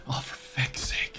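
whispered frustrated curse; muttered under breath. Real, not acted. Player cursing the creature under his breath, whispered. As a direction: breathe it out, slow and annoyed.

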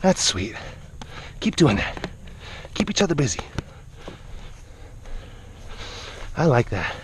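low, soft and tender. Real, not acted. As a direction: murmur gentle approval. Soft low praise to animals.

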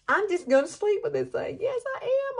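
thrilled, high-pitched squeal; excited gushing. Real, not acted. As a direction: gush like you can't contain it. Gushing over her hair.

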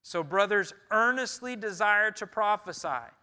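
loud, proclaiming Paul's words; forceful scripture reading. Acted. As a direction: proclaim the verses like a herald.

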